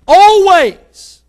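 high, loud, drawn-out cry of always; preaching shout. Real, not acted. Preacher crying always to the church at full force. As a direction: shout it to the rafters. Preacher hits peak line.

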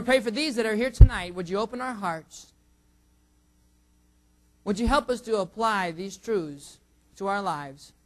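normal talking voice

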